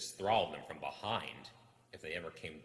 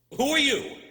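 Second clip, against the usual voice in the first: much higher, loud, frightened; startled cry; 17 dB louder than usual. Acted. The hobbit startled by eyes in the dark, frightened yelp. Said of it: "he said, thrusting his dagger".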